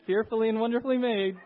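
mock retort. Shown as a demonstration. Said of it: "just go".

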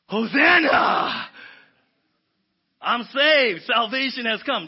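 loud, slow shout of relief; relieved celebration. Retold or imitated. Rescued boy shouts with relief as the lifeguard reaches him. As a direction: cry out that help has arrived. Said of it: "one is a celebration".

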